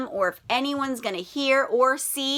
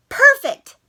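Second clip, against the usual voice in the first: high, loud triumphant cry; thrilled kid yelling perfect; delighted; 13 semitones higher, 9 dB louder than usual. Acted. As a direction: shout it proud of your work. Sydney finishes his trap.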